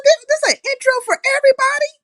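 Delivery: high, fast mock outrage; hammed-up jealous whine; mock indignant. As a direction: squeal it like you're offended. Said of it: "I said".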